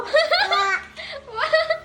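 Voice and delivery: high-pitched burst of laughter; laughing. Real, not acted. Adults amused by the child.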